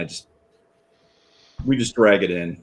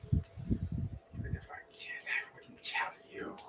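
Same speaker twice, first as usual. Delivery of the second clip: slow, rasping whispered defiance; creepy whisper; 16 dB quieter, slower than usual. Acted. Captive creature whispers defiance at its captor. Captured creature answers interrogation.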